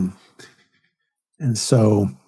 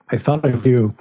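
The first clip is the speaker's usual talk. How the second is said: ordinary talk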